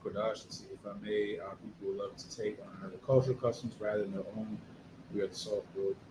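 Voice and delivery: hushed, murmured reading of the chat message; whispered reading. Real, not acted. Host reads a viewer's chat comment, whispered. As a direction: whisper it under the breath.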